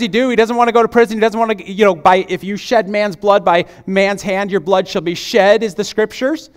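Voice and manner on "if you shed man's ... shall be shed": ordinary delivery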